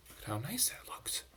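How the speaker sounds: hushed whisper pointing at the picture. Real, not acted. Reader whispering over the picture to the children. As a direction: whisper it, barely voiced.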